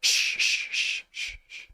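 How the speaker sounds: repeated shushes tailing off; shushing. Acted. Game master hushes the player's call, shushing. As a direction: shush them, fading with each one.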